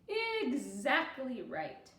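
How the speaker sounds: drawn-out, approving; satisfied slow confirmation; slow, emphatic agreement. Acted. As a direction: lean on each word slowly. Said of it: "Gooney Bird said".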